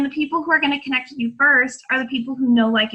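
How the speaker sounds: normal talking voice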